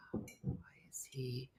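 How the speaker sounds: slow soft whisper, trailing off. Real, not acted. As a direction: breathe it out, barely voiced. Whispered musing.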